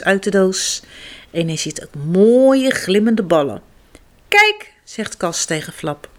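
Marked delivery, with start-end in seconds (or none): 4.3-4.7 put-on excited child. Kas shows Flap excitedly.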